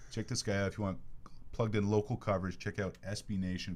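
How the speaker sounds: normal talking voice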